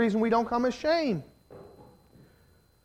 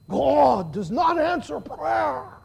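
loud, high, slow mock-British proclamation; formal British preacher, badly imitated; drawn-out, hammed-up British accent; 6 semitones higher, 5 dB louder, slower than usual. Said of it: "I can't do a good British accent".

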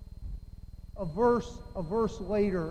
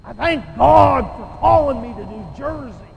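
Ron; 15 dB louder than usual. Retold or imitated. Preacher imitating a friend praying in the office, booming voice. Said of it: "start praying in that deep voice of his".

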